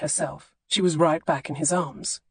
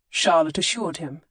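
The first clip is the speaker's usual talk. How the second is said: ordinary delivery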